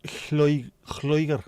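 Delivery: breathy, guttural first sound on "Lloegr"; tentative pronunciation. Shown as a demonstration. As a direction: sound out "Lloegr" slowly with a rasped opening. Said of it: "How the freaking hell do you pronounce that?".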